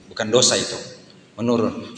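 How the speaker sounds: usual speaking voice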